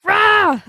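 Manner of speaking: short loud high growl of annoyance; frustrated roar. Real, not acted. Venting at a rude husband, a loud frustrated roar. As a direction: let out an angry "Rah!".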